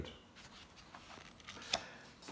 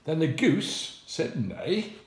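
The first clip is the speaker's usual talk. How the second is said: drawn-out neigh; 4 semitones higher, slower than usual. Acted. Goose imitating a horse.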